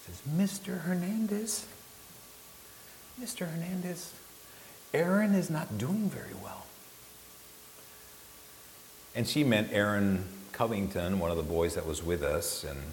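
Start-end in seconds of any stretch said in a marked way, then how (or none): 0.3-6.7 put-on high, sweet little-girl voice; timid girl piping up from behind; sweet, soft girl. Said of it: "sweet, soft voice of a girl".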